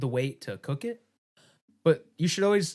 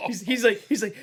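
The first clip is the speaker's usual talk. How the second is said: ordinary delivery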